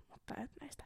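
whispered aside; whispering the secret to the viewers. Real, not acted. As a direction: breathe it out unvoiced.

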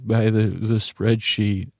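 ordinary talk, nothing marked

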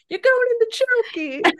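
very high, loud hammed-up headmistress shriek; Trunchbull. Retelling how she scared people in college, shrieky villain voice. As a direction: imitate a tyrant headmistress sentencing a child. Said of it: "a closet and go".

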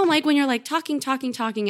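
ordinary talk

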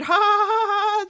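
high, loud mock evil cackle; villain laugh. Doctor gloats at the trapped crew with a high mock evil laugh.

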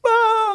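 high mock wail of despair; a retold burst of tearful wailing. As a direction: let out a sudden high cry. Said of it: "and I'd go".

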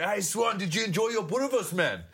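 high, teasing heckler voice; taunting fan. Retold or imitated. Heckler in the crowd taunting Swan about the sausage, high teasing voice. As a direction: needle him from the stands.

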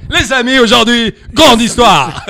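full-blast presenter send-up; Alexandre Ruiz. Retold or imitated. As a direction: open the show at maximum volume. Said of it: "il est tout le temps à donf". (présentateur à donf, imité)

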